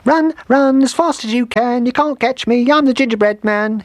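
very high little gingerbread man; gleeful taunting call. A narrator's reading. Gingerbread man escaping the cows, gleeful high call. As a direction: sing out the taunt in a tiny squeaky voice. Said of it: "he called with glee".

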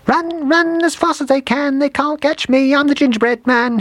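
very high gingerbread man voice; gleeful taunting shout. A narrator's reading. Gingerbread man leaving the village, gleeful high shout. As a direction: squeal the taunt with glee. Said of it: "he shouted with glee".

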